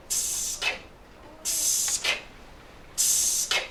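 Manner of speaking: long sharp hiss cut off with a click; monster's hiss. Acted. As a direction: hiss it out, then click shut. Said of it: "because he'll say".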